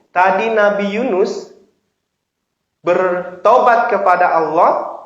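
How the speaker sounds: ordinary talk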